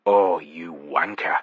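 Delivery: slow, low, sighed insult; weary sigh. A narrator's reading. Narrator sighing at the friend who pranked him. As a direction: breathe it out in defeat. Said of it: "I sighed".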